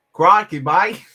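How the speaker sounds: hammed-up Australian accent, loud and high. Shown as a demonstration. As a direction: put on a bad, over-the-top Aussie accent. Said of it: "my best Australian accent".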